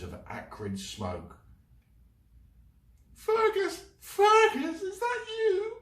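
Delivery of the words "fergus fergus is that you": high loud tearful cry. Acted. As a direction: cry out to him, close to tears. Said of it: "Sylvie's tearful voice".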